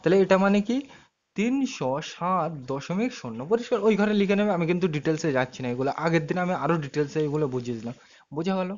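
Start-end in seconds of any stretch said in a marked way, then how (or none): 1.4-3.4 dictating slowly to students; slow digit-by-digit reading. Shown as a demonstration. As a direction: read "তিনশো সাত দশমিক শূন্য" very slowly, each part on its own. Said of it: "একটা একটা করে উচ্চারণ করতে হয়".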